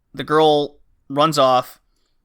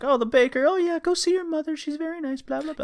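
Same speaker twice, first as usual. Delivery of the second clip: much higher, sing-song friendly villager voice; cheery villager; 14 semitones higher, 4 dB quieter than usual. Retold or imitated. Townsfolk greeting the girl, high sing-song cheer. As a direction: chirp like a sweet small-town neighbour. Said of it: "you're just like".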